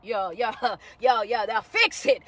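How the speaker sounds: quiet laughing follow-up; laughing aside. Real, not acted. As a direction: laugh it off under your breath. Chuckling after the shout.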